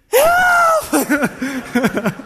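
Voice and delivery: high, hammed-up scream; mock cry for help. Preacher imagining himself in Peter's place, mock scream for help. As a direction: yell it in pretend panic.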